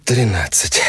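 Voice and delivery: exhaled. Acted. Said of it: "выдохнул Витя".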